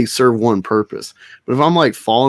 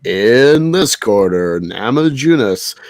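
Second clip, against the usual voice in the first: slow, booming ring announcer intro; drawn-out louder boxing announcer call; 4 dB louder, slower than usual. Retold or imitated. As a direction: stretch every word like a fight night MC. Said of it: "the ringmaster or whatever is like".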